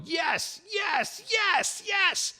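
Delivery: very high-pitched mock scream of excitement; high, squealed cheer, imitating someone thrilled; imitated screaming. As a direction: mimic the doctor screaming in triumph. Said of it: "at least it was you screaming yes".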